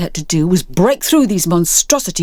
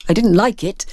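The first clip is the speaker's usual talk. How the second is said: usual speaking voice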